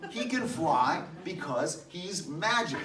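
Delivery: put-on little girl insisting with excitement; emphatic, excited child. A narrator's reading. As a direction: explain it slowly as the obvious answer. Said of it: "her voice was emphatic with the excitement".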